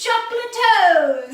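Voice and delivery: very loud, high squeal; excited shout. Real, not acted. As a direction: scream it back with glee. Echoing the shout even louder.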